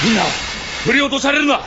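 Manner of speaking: loud, high yell over danger; urgent cry to the crew; shouting a warning. Acted. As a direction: shout to hold on tight. Raft attacked by giant creature.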